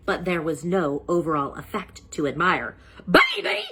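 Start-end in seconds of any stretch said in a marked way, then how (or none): 3.1-3.6 high, loud call of her sister's name; scream. A narrator's reading. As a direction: yell it out in outrage. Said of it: "screamed Eugenia".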